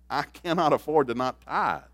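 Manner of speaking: slow, forceful preacher repetition; preaching emphasis. Acted. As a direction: drive it home from the pulpit. Preacher pounding point.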